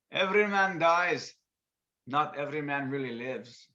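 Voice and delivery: put-on loud, slow Scottish accent; bad Scottish impersonation. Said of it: "throw on a bad impersonation".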